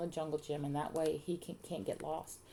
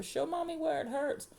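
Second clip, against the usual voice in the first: put-on high-pitched baby talk; doting mother; 11 semitones higher than usual. A narrator's reading. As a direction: coax a hurt child in a high motherly voice. Mother fussing over hurt child.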